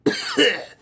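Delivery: loud hacking smoker's cough. Acted. Host mimicking an old smoker breaks off, loud cough. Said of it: "you got a smoker's cough".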